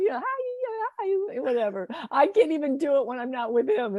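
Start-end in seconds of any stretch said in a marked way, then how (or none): 0.2-1.4 put-on very high child's hi; playful little kid voice. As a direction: chirp it like a small kid. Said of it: "like little children".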